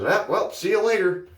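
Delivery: high-pitched goofy comic farewell; Jim Carrey. Retold or imitated. Weird stranger leaving the shopper, goofy high-pitched mimicry. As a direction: imitate a goofy oddball signing off, pitch way up. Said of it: "Like Jim Carrey from Dumb and Dumber".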